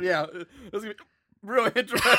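laughing through hiccups; hiccuping and laughing. Real, not acted. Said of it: "Oh my God, the hiccups".

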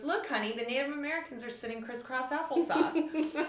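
a hint of a teasing husband; husband's joke. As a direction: tease the wife with a deadpan jab. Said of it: "and he goes".

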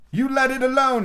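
high, loud puffing frog voice; breathless old frog warning; panting frog. A narrator's reading. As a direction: frog warning Alice as he hobbles off. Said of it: "he panted".

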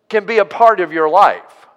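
ordinary talk, nothing marked

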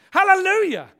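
loud, high hallelujah, falling at the end; exultant praise. Real, not acted. Preacher praising God for doing it, exultant shout. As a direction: burst out in praise.

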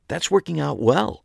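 a hint of pride. Shop owner about her book corner, a hint of pride. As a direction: let a little pride slip in. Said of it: "with a hint of pride in her voice".

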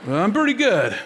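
self-satisfied human. Acted. Preacher mimicking people who judge others' sin, mock self-satisfied. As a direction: play someone smugly excusing themselves. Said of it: "we're all like".

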